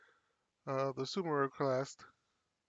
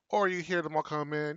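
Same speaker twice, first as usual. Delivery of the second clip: normal talking voice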